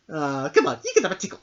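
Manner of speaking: ordinary talk, nothing marked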